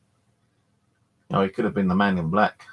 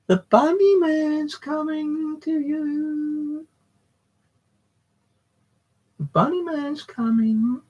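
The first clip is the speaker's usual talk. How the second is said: very high, slow eerie singsong; spooky bunny man; slower than usual. Pretend. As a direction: creep her out with a thin, high ghostly voice. Teasing Rose who is watching.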